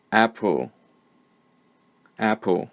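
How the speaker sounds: "apple" said alone, stress on AP, reduced ending; careful word model. Shown as a demonstration. Teacher saying the word so learners find the schwa, careful model. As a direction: dictate "apple" as AP-uhl, then repeat it. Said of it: "I'm going to say the word".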